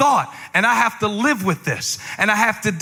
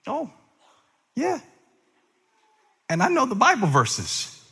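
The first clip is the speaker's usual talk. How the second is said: ordinary delivery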